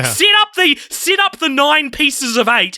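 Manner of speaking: real frustrated outburst; exasperated shout. Real, not acted. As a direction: blow up at the filmmakers' obvious mistake. Exasperated rant about the sequels.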